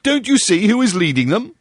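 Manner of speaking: high, eager pointing-out; high call to friends who can't see; excited Bull. A narrator's reading. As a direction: urge them to look. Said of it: "cried Bull".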